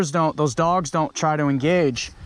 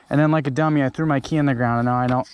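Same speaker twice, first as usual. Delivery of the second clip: usual speaking voice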